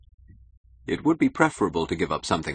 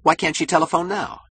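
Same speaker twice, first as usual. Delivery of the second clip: high, loud, eager question; impatient urging; 12 semitones higher, 5 dB louder than usual. Acted. Keating pushes for an immediate call, impatient. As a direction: press urgently for action now.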